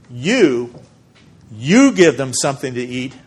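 You, you give them something to eat, both You hit loud; emphatic command. Shown as a demonstration. Pastor paraphrasing Jesus' order to the disciples, firm and emphatic. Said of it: "That's an order".

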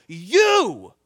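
mock yell from across a store; acted yell. Shopper spots someone across the store, acted yell. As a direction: call out a loud, accusing YOU. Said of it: "and they yell".

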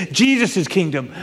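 ordinary delivery

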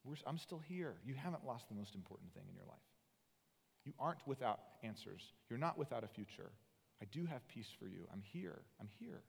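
soft, tender reassurance; God softly comforting a grieving Moses. Acted. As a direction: hush down and comfort him gently. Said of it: "And God says to him tenderly".

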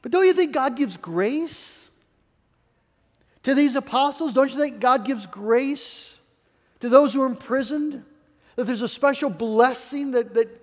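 usual speaking voice

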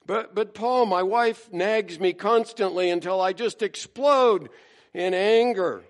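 mock-whiny, begging Paul to understand; hammed-up grievance; whiny complainer.

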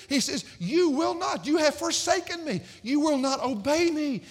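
high, forceful rebuke in God's voice; sustained high-pitched preacher's intensity; impassioned preaching. Acted. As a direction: plead and accuse from the pulpit.

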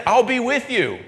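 high, lifted promise; lightly voiced Lord; voicing the Lord. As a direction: speak as the Lord, gently lifted. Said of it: "He says".

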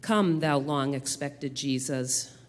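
ordinary talk

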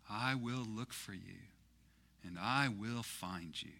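sudden drop to a soft, deliberate vow; Liam Neeson. Retold or imitated. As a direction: let the threat fall soft and slow. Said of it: "Liam Neeson from Taken".

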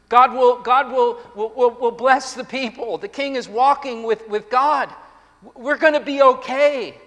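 relieved people bursting out, tripping over words; excited relief. Acted. The people hearing their king trusts God, excited.

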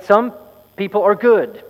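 loud, deliberate oracle; slow and loud. Acted. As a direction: spell it out to people who didn't hear. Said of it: "he said slowly and loudly".

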